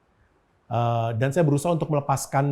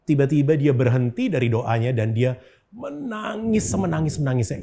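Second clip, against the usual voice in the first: usual speaking voice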